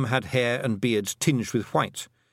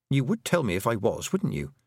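usual speaking voice

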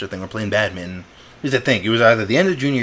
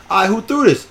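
higher, louder challenge; mock confrontation; 10 semitones higher, 6 dB louder than usual. Hit kid demands who threw the pencil, confrontational. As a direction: act out an annoyed kid calling someone out.

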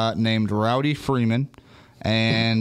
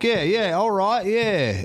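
higher-pitched mock British voice; British accent; 8 semitones higher, much slower than usual. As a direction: put on your party-trick British accent. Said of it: "like, in your British accent".

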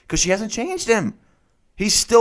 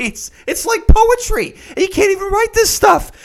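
very high, laughing incredulity; amused disbelief; 5 dB louder than usual. Real, not acted. Podcaster marvelling at the film's ending, laughing incredulously. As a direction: squeal it through a laugh, loud.

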